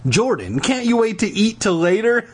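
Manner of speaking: high-pitched, disbelieving complaint; exasperated girl. A narrator's reading. Lauren scolds Jordan.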